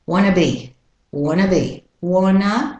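"Wanna be" as one smooth unit; casual linked "wanna be"; reduced "wanna". Shown as a demonstration. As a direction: drop the T in "Wanna". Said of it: "want to devolves into wanna".

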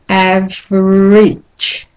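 "average" stretched very slowly, sound by sound; slow-motion model of "average"; drawn-out demonstration. Shown as a demonstration. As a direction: draw out "average" for a learner. Teacher stretches the word to demonstrate.

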